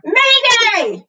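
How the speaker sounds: very high, loud bird shriek. A narrator's reading. Wiffle bird warning everyone, shrieking. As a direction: screech it like a panicked bird. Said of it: "she shrieked".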